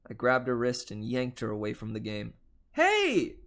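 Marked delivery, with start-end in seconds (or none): 2.7-3.4 sharp, high, loud yelp of protest; piercing scream. Acted. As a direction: yell it like she's been yanked by the wrist. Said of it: "She screamed".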